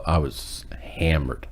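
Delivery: whispered, slow confession; slow husky whisper; hushed admission. Real, not acted. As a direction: breathe it out like a guilty secret.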